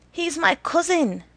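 high, loud call with falling end; calling after. A narrator's reading. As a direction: call it out after people walking away. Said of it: "called after them".

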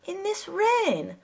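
high, slow incredulous question; drawn-out indignant exclamation, pitched high; indignant cry. A narrator's reading. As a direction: protest in outraged disbelief. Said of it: "I cried indignantly".

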